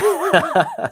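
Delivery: high burst of laughter; real laugh. Real, not acted. Streamer cracking up at own tongue-tie, high laugh. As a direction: laugh helplessly at your own slip.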